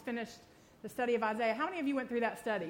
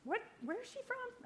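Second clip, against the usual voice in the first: confused listener; 11 semitones higher, 9 dB quieter than usual. Pretend. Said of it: "just be like".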